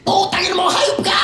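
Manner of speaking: high, loud Tagalog screaming curse; furious Filipino cussing. Retold or imitated. Cook cussing out Sean Connery, screaming. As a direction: yell it at him in fury. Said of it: "Rewind it".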